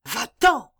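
insistent. Acted. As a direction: push the girl out firmly. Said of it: "dit Rosa avec insistance".

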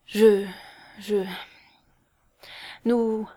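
broken words with long halts; falter, unable to get started; stammering. A narrator's reading. Girl struggling to confess a kiss, stammering. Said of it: "elle balbutia encore".

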